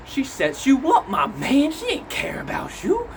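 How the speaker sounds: high-pitched comic accusation, dropping to near-whisper mid-way; mock accusing voice. Others warn about Clarence, mock accusing. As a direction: squeal it like a scandalised gossip. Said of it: "everybody else think".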